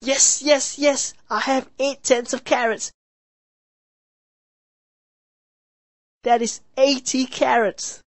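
high happy brother. A narrator's reading.

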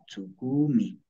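"tsukumi" with the ku held long; "tsukumi" said low as tsukuu-mi; stretched U. Shown as a demonstration. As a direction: lengthen the u before "mi" in "tsukumi". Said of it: "Decirlo la U".

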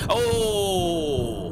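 loud startled yelp; startled cry. Real, not acted. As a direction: jump at the fright. Sudden fright.

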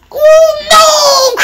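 very high, drawn-out wail of alarm; crying out. A narrator's reading. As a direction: cry out in storybook dismay. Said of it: "cried the freight car".

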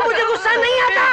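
high, loud yelled denial; furious shout. Acted. Man yelling at his friend that he isn't angry. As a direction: bellow it, losing control.

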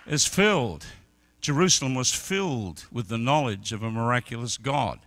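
loud, high-pitched sermon climax; full-force preaching. Acted. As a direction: preach it out, building to the roar.

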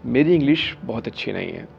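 ordinary talk

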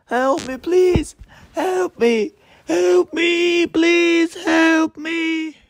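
high squeaky pleading voice; squeaky cries for help. Pretend. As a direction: squeal for help like a tiny figure. Toy victim squealing for help.

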